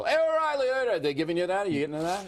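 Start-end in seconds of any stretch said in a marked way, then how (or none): 0.0-0.7 high-pitched mock shout from passers-by; imitated street yell. People on New York streets spotting him, mock yell. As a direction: imitate someone calling out a name in the street. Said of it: "people yell".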